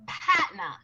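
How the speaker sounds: put-on playful Boston accent on "Patna". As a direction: drop the R, Boston style, in "Patna". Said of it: "Did I do a good Boston accent".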